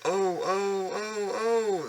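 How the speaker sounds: high squealing cries; crying trucks. Acted. Trucks bump into each other, high squealing cries. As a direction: wail like bumped little trucks. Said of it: "they cried again".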